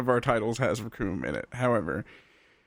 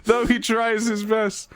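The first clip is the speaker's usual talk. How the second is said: mock dramatic; 9 semitones higher, slower than usual. Mock-epic reading of Krillin's failure.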